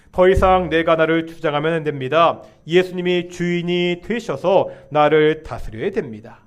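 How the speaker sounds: ordinary talk, nothing marked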